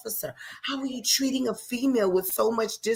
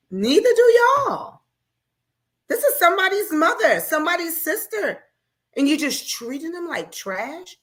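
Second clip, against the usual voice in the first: much higher and louder, crying out; furious outcry; 10 semitones higher, 3 dB louder than usual. Real, not acted. To the officers about mothers and sisters, furious. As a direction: let the anger break out high.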